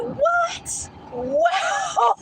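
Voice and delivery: high stunned what then a laugh; shocked what. Retold or imitated. Woman mocked for dancing, high shocked what and laugh. Reacting in disbelief.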